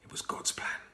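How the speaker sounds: hushed whispered aside. A narrator's reading. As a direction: breathe it like a secret comfort. Narrator's hushed reassurance.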